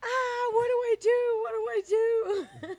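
high, loud panicked squeal. Retold or imitated. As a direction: shriek it in a flustered panic. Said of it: "And I was like".

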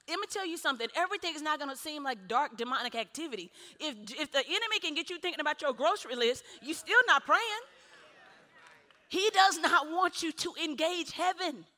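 urgent sermon build; emphatic preaching. Real, not acted. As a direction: warn the congregation, building in force. Preaches at full force.

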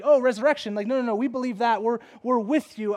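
animated mock Pharisees rallying round; eager agreement. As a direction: jump in to back him up. Acts out Pharisees eagerly siding with Paul.

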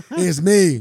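loud, high "It's me" as recorded; recorded caller name. Retold or imitated. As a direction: shout your name into the prison recording. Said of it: "'Cause you get that little voice".

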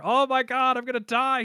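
exaggerated dying-man panic; mock scream. [name] screaming before a staring crowd, mock scream. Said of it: "He's just screaming".

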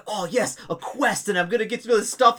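a hint of a keen middle-aged priest; eager priest. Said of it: "who is like".

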